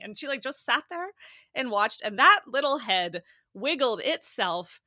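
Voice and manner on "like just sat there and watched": usual speaking voice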